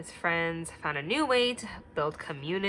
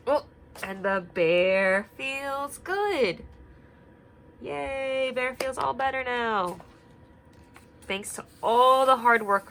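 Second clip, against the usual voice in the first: higher, happy storytime cheer; cheerful excitement; 5 semitones higher, 5 dB louder than usual. Acted. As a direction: celebrate with the kids. Bear recovered, cheering.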